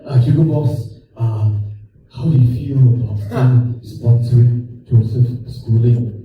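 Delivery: low, measured questioning voice; lightly voiced reporter. Newspaper calls the sponsor Hugo Boss, lightly voiced formal questioner.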